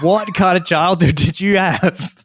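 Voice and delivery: high, mock disbelief; incredulous. Friend reacting to a strange childhood story, mock disbelief. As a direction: ask it in amused shock. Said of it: "You'd be like".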